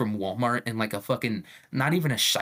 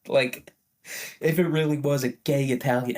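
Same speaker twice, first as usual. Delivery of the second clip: usual speaking voice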